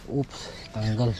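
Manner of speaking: usual speaking voice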